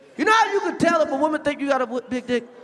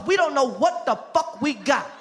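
usual speaking voice

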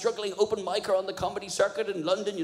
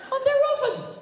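incredulous; 4 dB louder than usual. Acted. Incredulous rant.

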